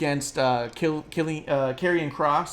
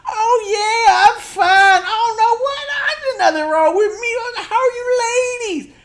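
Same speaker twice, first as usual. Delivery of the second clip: weird cartoon yokel; 9 dB louder, slower than usual. Grimes chatting up two women, hammed-up yokel voice. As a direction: overplay a goofy yokel flirting. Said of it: "all weird".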